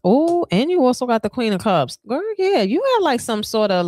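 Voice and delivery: jumps high and bright with delight; excited gush. Real, not acted. Tarot reader hyping her client about the Queen of Cups, delighted high squeal.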